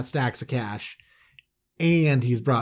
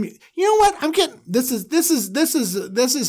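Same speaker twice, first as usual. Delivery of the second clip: excited stammer; 11 semitones higher than usual. Real, not acted.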